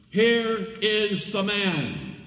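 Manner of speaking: high, very slow proclamation; presenting slowly. A narrator's reading. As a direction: hold out each word to the crowd. Pilate presents Jesus.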